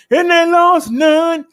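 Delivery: high, loud and slow, "lost" stretched in delight; triumphant call. Acted. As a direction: crow it out like a victory. Triumphant punchline in stats report.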